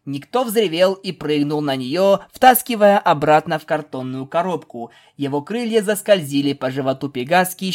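plain reading voice

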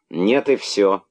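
firm, stern refusal; strict final no. A narrator's reading. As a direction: shut the matter down, strict mother. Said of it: "более строгим голосом".

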